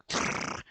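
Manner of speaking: rider's mouth-made machine gun; rapid-fire gun noise; imitated gunfire. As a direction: rattle off voiceless gunshots. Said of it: "the person on top of it's like".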